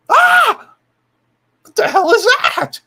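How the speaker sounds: high, loud startled yelp; startled puppet. Retold or imitated. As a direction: jump back with a shocked yelp.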